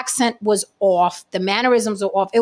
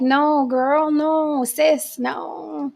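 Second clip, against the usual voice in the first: higher, drawn-out sassy no; sassy disapproval; 6 semitones higher, much slower than usual. Retold or imitated. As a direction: shake your head at her, sis. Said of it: "We were like".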